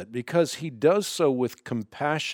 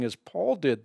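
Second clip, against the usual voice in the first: ordinary delivery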